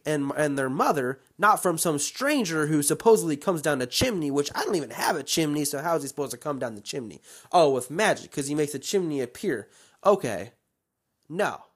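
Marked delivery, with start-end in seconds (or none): none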